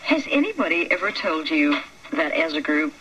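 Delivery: usual speaking voice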